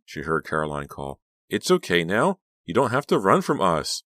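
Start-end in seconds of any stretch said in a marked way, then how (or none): 1.5-4.0 lightly voiced girl, pitched higher; coaxing girl's voice. A narrator's reading. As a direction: coax a frightened friend back. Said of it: "Caroline's voice had returned to normal".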